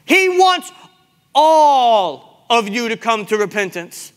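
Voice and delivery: impassioned sermon delivery; full-force preaching. Real, not acted. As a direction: proclaim it slowly to a full church.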